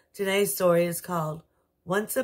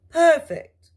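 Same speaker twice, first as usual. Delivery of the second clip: high, bright fairy godmother voice, much higher; 6 semitones higher, 6 dB louder than usual. Acted. Fairy godmother greeting the king and queen, high and cheery.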